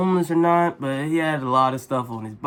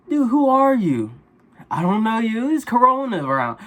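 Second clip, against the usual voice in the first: much higher and louder, startled pushback; alarmed outburst; 9 semitones higher, 5 dB louder than usual. Retold or imitated. To the stranger begging a hit, startled and high. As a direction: jolt back in alarm at the stranger.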